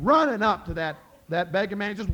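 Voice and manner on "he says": usual speaking voice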